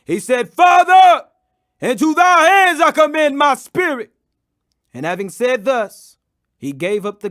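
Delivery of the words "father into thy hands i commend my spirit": very loud, high final cry; a dying man's last loud call to the Father. Acted. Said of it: "cried with a loud voice".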